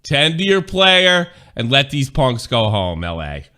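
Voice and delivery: loud, slow country twang; country accent. Shown as a demonstration. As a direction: scold the crowd like a country fan. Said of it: "the country accent".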